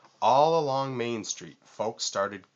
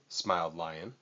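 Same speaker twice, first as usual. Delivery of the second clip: plain reading voice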